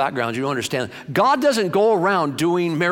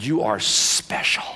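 tender blessing; 6 semitones lower, slower than usual. Retold or imitated. Samuel blessing young David with oil, tender and slow. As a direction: lay it on him gently.

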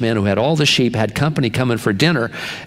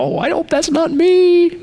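a falsetto squeak of self-doubt; mock high worried voice. To the congregation, a high squeaky worried aside. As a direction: pitch it way up, playfully anxious. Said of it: "and you go".

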